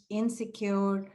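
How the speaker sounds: ordinary talk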